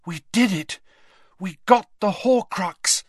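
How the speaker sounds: high, triumphant breathless exclaim; excited cry. Acted. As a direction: shout out the victory, elated. Harry triumphant after escaping danger.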